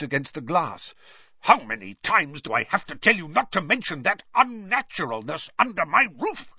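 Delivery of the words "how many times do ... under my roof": louder, higher furious hiss. Acted. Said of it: "he hissed".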